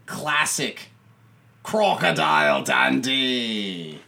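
slow, drawn-out mock-Aussie announcement; Australian accent. Host introduces the film, exaggerated Australian accent. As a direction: draw out the title in a hammed-up Australian accent. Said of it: "the Australian accents today".

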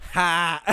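a loud, high mocking "Ha!"; mocking laugh. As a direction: bark out a scornful laugh. Friend's mocking laugh retold.

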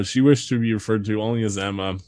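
ordinary delivery

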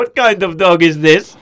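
put-on loud, slow foreign-accented question; Borat. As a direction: ask emphatically in a comic foreign accent. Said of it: "Borat asks what things are".

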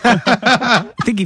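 said slowly and high through laughter; laughing. Real, not acted. Laughing while telling.

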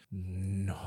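low, soft, drawn-out mock refusal; creepy refusal. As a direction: say it low and quiet as the odd client. Acting creepy client's refusal.

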